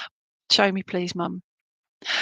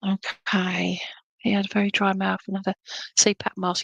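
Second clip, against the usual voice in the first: hushed whisper, fast on the second line. Real, not acted. Medium telling Jacqueline how he died, whispered. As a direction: drop to a whisper for the sad detail. Medium quietly relaying his death.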